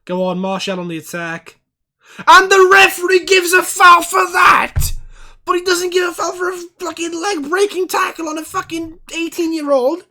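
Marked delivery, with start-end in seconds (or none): 2.3-10.1 yelling high and loud, slowed down, at the ref; furious shout. Real, not acted. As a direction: bellow your outrage at the referee. Shouting at the referee.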